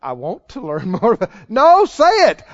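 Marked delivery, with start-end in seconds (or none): 0.0-1.3 soft, low, hesitant stalling; uncomfortable mumbling. Acted. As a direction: imitate squirming reluctance, much quieter. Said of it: "It just seems uncomfortable to them".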